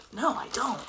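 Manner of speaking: ordinary talk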